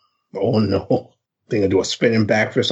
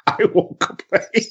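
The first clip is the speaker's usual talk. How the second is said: ordinary talk, nothing marked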